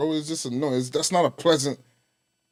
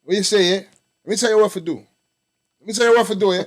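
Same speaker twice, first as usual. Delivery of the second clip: a playful, lightly put-on Jamaican accent, a touch louder; Jamaican patois; 3 semitones higher, 4 dB louder, faster than usual. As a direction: greet the friend in mock Jamaican. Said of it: "Let me hear a wagwan".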